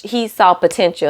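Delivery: ordinary talk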